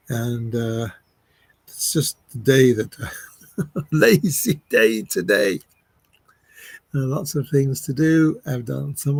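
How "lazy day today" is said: slow, chuckled admission; drawn out with a chuckle; amused. Real, not acted. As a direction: laugh lightly as you own up to it. Admits a slack day, chuckling.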